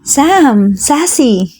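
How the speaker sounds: light up on seeing the cousins; excited greeting. Acted. Sarah greeting her surprised cousins, excited. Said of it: "nagulat pa siya at biglang lumawak ang ngiti niya".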